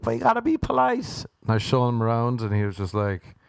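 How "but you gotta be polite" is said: much higher, sing-song "but you gotta be polite"; mock polite squeak. Telling crowd his inner thoughts: high mock politeness.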